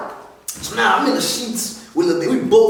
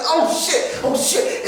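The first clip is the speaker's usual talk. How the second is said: panicked; 8 semitones higher, slower than usual. Retold or imitated. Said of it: "I'm like".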